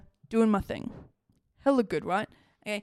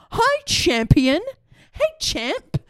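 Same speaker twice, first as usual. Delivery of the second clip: higher, drawn-out mock greeting; riled-up taunting; 7 semitones higher, 4 dB louder, much slower than usual. Riled-up woman greets the hated neighbour, taunting. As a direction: needle him with the nickname, pitched up. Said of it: "I was all mad and riled up".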